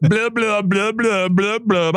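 high mocking blah blah, dismissive; mock the rambling with a high singsong; scornful high-pitched blah blah. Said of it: "On and on he went with his rambling".

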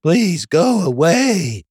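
high, slow, weary begging; exasperated plea. Retold or imitated. Annoyed site visitor begging the pop-up chatbot, exasperated. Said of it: "And you're like".

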